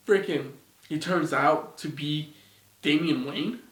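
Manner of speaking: normal talking voice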